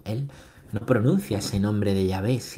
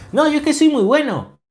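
high, loud mimicry of a protester; mock objector; 9 dB louder than usual. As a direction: protest your own goodness in a high mock voice. Mimics a self-satisfied objector. (imitando una protesta con voz aguda)

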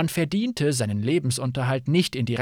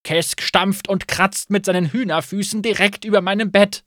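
whining; 5 semitones higher, 4 dB louder than usual. Acted. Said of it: "jammerte Vake".

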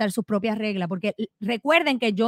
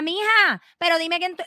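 mock yell; 6 semitones higher than usual. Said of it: "gritándome".

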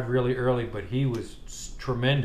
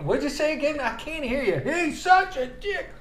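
squeaky mock voice. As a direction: squeak it out like a jeering teen. Mocking the coach's teasing.

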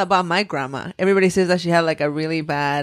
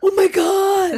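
big gasp; 12 semitones higher, 4 dB louder, slower than usual. Real, not acted. Gasp at toy story.

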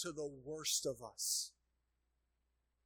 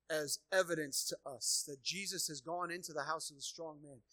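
ordinary talk, nothing marked

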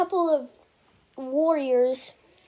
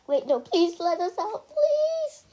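rising into a much higher desperate please; pleading; 7 semitones higher than usual. A narrator's reading. Harry begs the knight to let them out, pleading higher and higher. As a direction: beg harder with each please. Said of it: "Harry pleaded".

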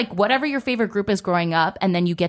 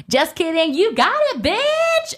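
high-pitched excited yell, climbing higher; gleeful prank reveal; 12 semitones higher, 3 dB louder, slower than usual. Retold or imitated. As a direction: squeal the good news like a manager springing a surprise. Manager reveals the prank good news.

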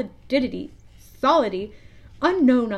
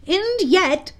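higher, louder character voice; Smee the pirate; 9 semitones higher, 6 dB louder than usual. A narrator's reading. Smee reminding his captain about his weapon, in a high pirate-sidekick voice. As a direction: pipe up as the loyal sidekick. Smee's voice in story.

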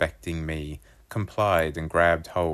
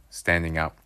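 ordinary delivery

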